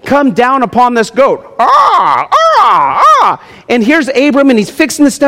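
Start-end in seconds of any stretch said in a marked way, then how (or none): 1.6-3.4 high, loud cawing shouts; loud mock crow caws. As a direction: caw like a flock of crows. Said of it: "a whole murder of crows".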